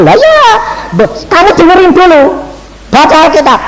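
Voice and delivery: mock exasperated lecture; exasperated scolding. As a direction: lay down the law, exasperated. Preacher scolding the caller.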